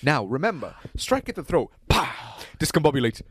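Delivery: put-on lightly done posh British accent; hint of a jolly British gent; mock British accent coaching a fight; British Batman. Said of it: "Batman ... in a British accent".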